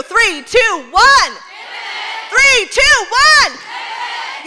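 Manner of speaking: high, loud rallying countdown yelled out; countdown shout. Acted. Rallying the conference crowd to pivot, shouting the countdown. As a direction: count it down like launching a rocket, full voice.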